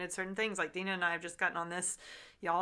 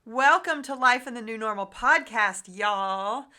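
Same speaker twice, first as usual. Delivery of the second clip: bright, upbeat show opener, a bit louder; podcast host intro; 3 semitones higher, 8 dB louder than usual. Acted. Host opening the podcast for listeners, upbeat intro. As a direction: welcome the listeners like a radio host.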